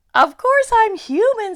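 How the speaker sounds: loud, high puzzled Lucy; insist, baffled; loud puzzled girl; puzzled insistence. Acted. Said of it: "still a little puzzled".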